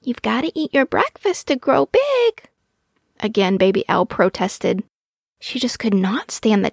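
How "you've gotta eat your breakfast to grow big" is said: lightly voiced coaxing mother, higher pitch; Mama Owl. A narrator's reading. Mother owl urging breakfast on her baby, lightly coaxing. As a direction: coax a little one, lifting the pitch. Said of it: "Mama tried again each night".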